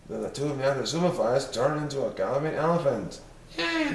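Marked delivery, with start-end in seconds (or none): 3.6-3.9 put-on high kids' cheer; children cheering. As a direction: cheer like small kids. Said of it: "the five little Popsnuggles shouted".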